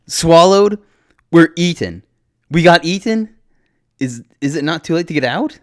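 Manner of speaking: high, loud, panicked questioning; alarmed disbelief. Acted. Panicked hero learns they were eaten.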